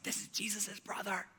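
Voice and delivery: whispered in awe; awed whisper among the churchgoers; hushed crowd whisper. Retold or imitated. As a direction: breathe it out like a stunned crowd. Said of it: "the crowd is like".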